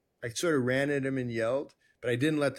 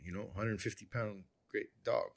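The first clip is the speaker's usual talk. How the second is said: ordinary talk, nothing marked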